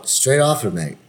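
normal talking voice

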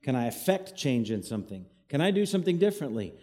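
normal talking voice

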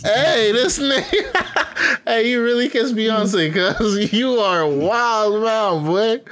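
high laughing disbelief, slow; real amused teasing laugh. Real, not acted. Laughing at the kiss clip.

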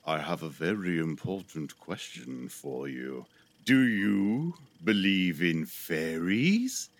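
low, slow mysterious host question. Acted. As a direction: lean in and tease the audience. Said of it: "and says".

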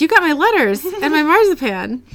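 high, loud, giddy squeal of delight; gushing fangirl. Acted. Superfan to her favorite author, giddy gushing. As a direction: gush with a giggle breaking in. Excited fan quote, acted.

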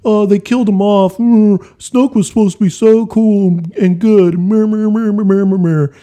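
loud, high mocking whine; mock the fanboys, loud and high, dissolving into nonsense; sneering parody of gushing nerds; whiny nerdy fanboy. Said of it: "nerdy fanboys were like".

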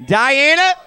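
name thrown out high and loud, rising sharply; high, loud, sassy summons; with attitude. Shown as a demonstration. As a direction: say it as if about to yell at them. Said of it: "Say their name with an attitude".

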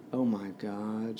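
low, soft, slow dismay; muttered. A narrator's reading. Shepard reacts to the child's question, muttering softly. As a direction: mutter it slowly under your breath. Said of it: "Shepard muttered".

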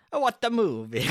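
hammed-up Italian accent, high and loud; Mario. Host tries reading a director's letter, mock Italian accent. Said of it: "it's just gonna be Mario".

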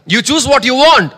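loud, emphatic charge; bold counsel. Shown as a demonstration. Preacher showing how counsel to a young man should sound. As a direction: lay the choice before them firmly. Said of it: "Your counsel must sound like that".

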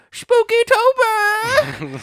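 loud, very high, drawn-out spooky holler; over-the-top high, slow catchphrase cry; theatrical catchphrase call. Pretend. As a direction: wail it out like a haunted-house announcement. Repeats the themed catchphrase call.

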